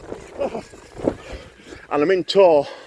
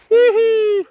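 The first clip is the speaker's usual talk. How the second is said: high giggle; 8 dB louder than usual. Pretend. Squeal after singing.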